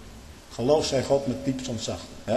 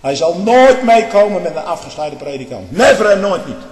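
forceful declaration; 3 semitones higher, 9 dB louder than usual. Real, not acted. Preacher declaring against separated churches.

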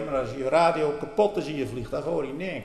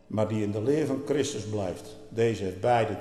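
normal talking voice

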